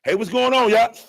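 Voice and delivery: high, loud hype greeting; mock an eager rapper's intro; imitating a rapper's hype hello, loud and high; album drop greeting. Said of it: "He can't even do that".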